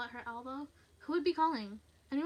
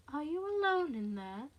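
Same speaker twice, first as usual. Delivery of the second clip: slow, lilting taunt; higher, drawn-out creepy question; teasing; 5 semitones higher, 3 dB louder than usual. Acted. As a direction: toy with the frightened listener. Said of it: "It was teasing now".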